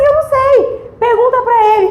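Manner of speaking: high-pitched, helpless protest; retold flustered reply. Speaker retells answering the helper about page prices, flustered. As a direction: toss the question back, pitched well up.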